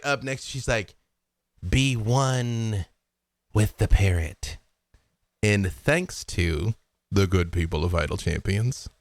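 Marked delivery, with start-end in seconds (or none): 1.7-8.2 slow, solemn little spirit; a hint of a mystic creature. Hollyphant to Havilar, slow solemn urging. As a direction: intone it slowly.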